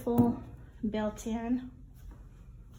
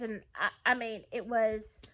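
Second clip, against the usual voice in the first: ordinary talk, nothing marked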